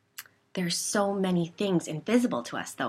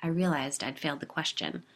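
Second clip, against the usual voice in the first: ordinary delivery, nothing marked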